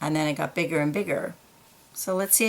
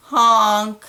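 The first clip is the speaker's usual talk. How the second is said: mournful elephant honk, a bit louder; sad trunk honk; 4 semitones higher, 6 dB louder than usual. A narrator's reading. Barnabas stuck in his jar, honking sadly. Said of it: "made a sad sound with his trunk".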